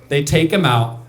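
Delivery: ordinary delivery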